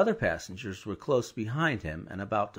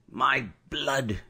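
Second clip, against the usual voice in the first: vexed; 3 semitones higher, 3 dB louder than usual. A narrator's reading. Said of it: "ejaculated the vexed coachman".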